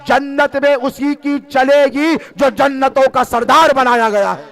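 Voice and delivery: loud, high pleading sermon; impassioned plea. Real, not acted. Preacher voicing sinners' plea to Husain, fervent.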